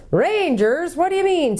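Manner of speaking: high, puzzled querying voice; hard-of-hearing dwarf. A narrator's reading. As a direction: query it sharply, having misheard. Deaf dwarf mishears the owl.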